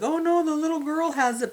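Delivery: high-pitched pretend panic; mock alarm. Host voices dad realising the danger, mock alarm.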